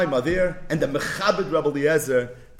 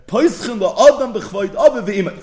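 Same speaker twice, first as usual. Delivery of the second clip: emphatic quote; 8 semitones higher, 9 dB louder than usual. Acted. Quoting Rabbi Eliezer emphatically.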